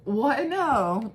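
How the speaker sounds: taken aback, voice jumping up; surprised objection. Real, not acted. Reacts to child's teasing answer.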